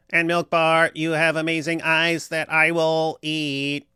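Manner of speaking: high stiff robot voice. Pretend. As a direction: read it like a robot. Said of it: "I'll just do a robot".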